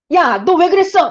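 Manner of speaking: mock angry scolding. Teacher shows what Jesus did not shout at the woman, as a mock angry yell. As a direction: bark it like an annoyed boss, then drop it. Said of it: "라고 하지 않아요".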